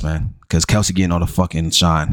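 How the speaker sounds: usual speaking voice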